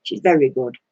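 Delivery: ordinary talk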